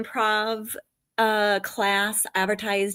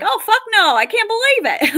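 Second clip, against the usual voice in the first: high, loud mock grandma outburst; imitating an Ohio grandma swearing in shock; 12 semitones higher, 7 dB louder than usual. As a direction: squawk it like a startled nana. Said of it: "she was like a grandma".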